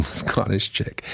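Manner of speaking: ordinary delivery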